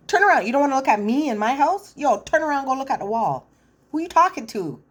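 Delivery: speaker's own version telling the man off, loud; indignant yelling. Pretend. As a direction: blow up at him in your own house. Speaker acts her own outburst.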